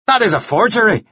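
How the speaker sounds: high-pitched, snapped accusation. A narrator's reading. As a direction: snap it back at her. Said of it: "Devlin snapped".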